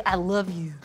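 mock American. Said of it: "I'm American".

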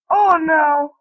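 a loud high "oh no"; alarmed cry. Real, not acted. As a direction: yelp as danger hits. Sudden danger in game.

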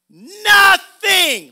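very loud, high preacher's shout; drawn-out, high proclamation; booming shout. Acted. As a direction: belt out the word from the pulpit. Shouts opening word of scripture.

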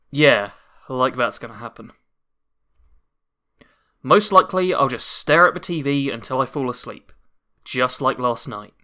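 low, flat sarcastic mutter; deflated self-mockery; bad acting. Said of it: "my terrible voice acting".

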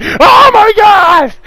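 very high, loud drawn-out mock scream; human-like deer scream. Telling friends about a screaming deer, mock human scream. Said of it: "it screamed like a fucking human".

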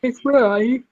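mid-yawn. Real, not acted. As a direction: talk through a yawn. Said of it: "I'm yawning".

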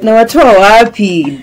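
loud, slow outraged repeat. Real, not acted. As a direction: belt out the question slowly.

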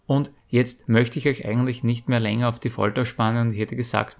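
ordinary talk, nothing marked

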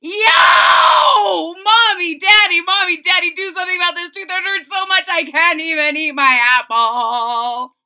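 high, loud frantic yell. A narrator's reading. Boy yells to both parents about his tooth. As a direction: holler for both parents at once. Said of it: "and yelled".

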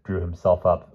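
ordinary delivery, nothing marked

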